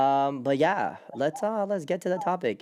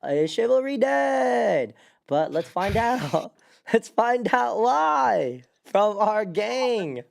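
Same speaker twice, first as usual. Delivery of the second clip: slow, loud, drawn-out topic reveal; dramatic host voice; 9 semitones higher, 5 dB louder, slower than usual. Acted. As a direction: tease the topic like an announcer.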